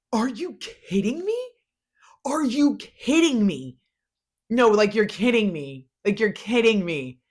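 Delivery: high, loud gushing disbelief; excited disbelief. Real, not acted. Gushing to viewers about a Love Island star's outfits, high excited disbelief. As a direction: rave about it at the top of your voice.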